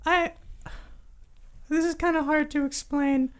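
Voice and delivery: high, squeaky, halting creature; hesitant kobold. Acted. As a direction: struggle to explain as a little kobold. Game master answers as kobold.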